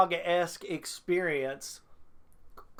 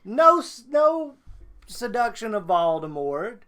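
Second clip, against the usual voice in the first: wrestling promo; 2 semitones higher, 4 dB louder than usual. Real, not acted. Calling out the fanfic author like a wrestler, loud. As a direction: cut a furious promo on the author. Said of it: "You gonna cut a promo?".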